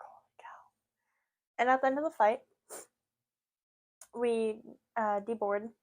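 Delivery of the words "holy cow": breathless whispered aside. Retold or imitated. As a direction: barely breathe the words out in awe. Whispered aside while telling story.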